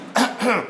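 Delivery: short put-on throat-clearing cough; demonstrative cough. Shown as a demonstration. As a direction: cough on purpose to show the word. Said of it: "I'm coughing".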